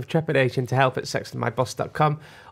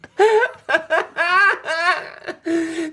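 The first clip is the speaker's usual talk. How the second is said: very high, long squealing laugh; helpless laughter; 4 dB louder than usual. Real, not acted. Podcaster cracking up at co-host, squealing laughter. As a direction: laugh till it turns to squeals.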